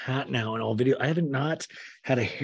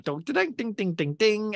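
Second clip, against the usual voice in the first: high ding-ding scene-change chime; imitated jingle; 7 semitones higher than usual. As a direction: sound out a bright little transition tune.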